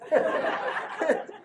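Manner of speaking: high, breathless laugh; breathy laugh. Real, not acted. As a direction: let a soundless laugh escape. Laughs recalling orange juice celebration.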